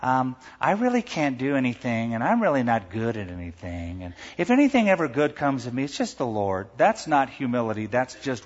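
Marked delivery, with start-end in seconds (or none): 0.0-6.7 a limp, self-pitying mealy-mouthed mumble. Retold or imitated. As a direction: whine it out like fake humility. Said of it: "you talk in a real mealy voice".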